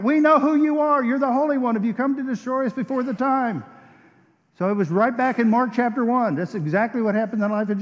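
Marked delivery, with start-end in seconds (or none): 0.0-3.6 crying out. Retold or imitated. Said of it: "a person starts crying out".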